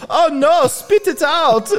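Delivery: high, stretched-out alarm; fussing mock panic, high and loud; panicked witch, in character. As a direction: stay in character while urging her to spit. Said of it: "I'm like "it's still in character".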